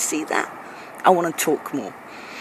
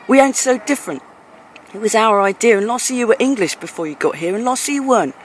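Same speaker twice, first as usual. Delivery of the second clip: normal talking voice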